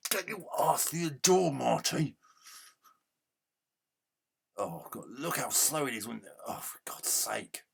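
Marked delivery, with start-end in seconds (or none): 0.1-2.2 higher, louder yell at the screen; angry shout. Real, not acted. Gamer yelling at Marty to get through the door, angry shout. 6.5-7.6 a defeated hushed curse; exasperated whisper. Real, not acted. Gamer exasperated at clunky game.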